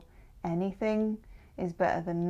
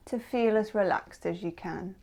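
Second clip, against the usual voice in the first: ordinary talk, nothing marked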